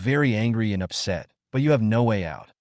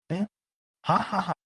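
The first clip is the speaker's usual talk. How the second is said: laughing; 9 semitones higher, 4 dB quieter than usual. A narrator's reading. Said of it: "Chen Xiao laughed".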